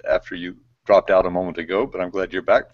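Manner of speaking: normal talking voice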